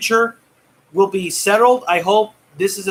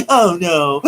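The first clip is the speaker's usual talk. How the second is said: ordinary delivery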